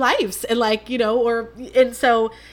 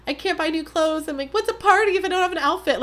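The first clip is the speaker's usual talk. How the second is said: higher, whiny self-mockery; mock dramatic whine; 8 semitones higher than usual. Mocking her past self to listeners, a whiny dramatic voice. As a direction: play up her own drama, lightly. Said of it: "She's so dramatic".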